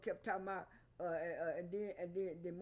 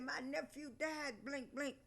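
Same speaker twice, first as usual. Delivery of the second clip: higher, slow, put-on sulky voice; a mock grieving tone aimed at Drew; mocking Marlo; 6 semitones higher, 3 dB quieter, slower than usual. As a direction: parody her mournful line slowly and high. Mocking Marlo's complaint, retold.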